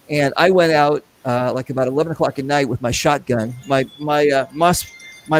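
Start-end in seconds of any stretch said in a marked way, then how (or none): none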